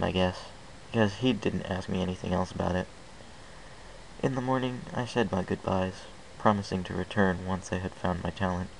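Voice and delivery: plain reading voice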